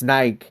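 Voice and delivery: Nike as one syllable, like bike; Nike rhyming with bike; European pronunciation. Shown as a demonstration. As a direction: say Nike with no final ee. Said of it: "I heard it's pronounced".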